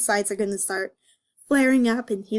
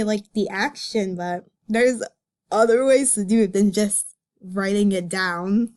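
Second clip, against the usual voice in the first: ordinary talk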